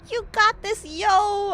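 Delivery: loud, high cheering; root for her like a fan; excited cheer. Real, not acted. Cheering on game character.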